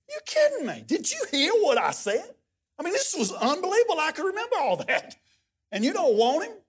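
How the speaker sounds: high-pitched mock disbelief; exaggerated incredulity. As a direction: squeal it in hammed-up outrage at being turned down. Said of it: "I didn't say it like that".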